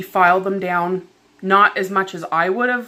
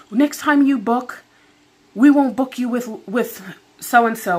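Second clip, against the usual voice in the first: imitating the owner; 4 semitones higher than usual. Owner's retold promise.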